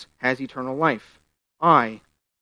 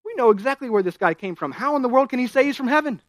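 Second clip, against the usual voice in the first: higher, louder scoffing villagers; indignant crowd; 9 semitones higher, 5 dB louder than usual. Retold or imitated. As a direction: scoff like neighbours who know his family. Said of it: "In other words".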